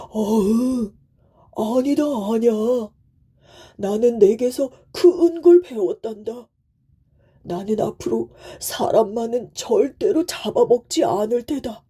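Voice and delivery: emphatic storybook tiger voice; kindly tiger, moved. Acted. Tiger spares the devoted son, moved. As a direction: promise solemnly as a reformed beast.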